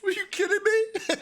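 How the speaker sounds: high squeal of disbelief; laughing disbelief. Retold or imitated.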